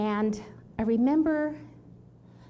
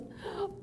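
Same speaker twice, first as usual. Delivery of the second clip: gasp; 10 semitones higher, 9 dB quieter than usual. Retold or imitated. Hildy sees her.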